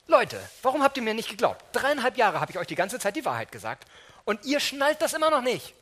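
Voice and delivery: hammed-up exasperated telling-off; mock reproach. Imagined scolding Jesus never said.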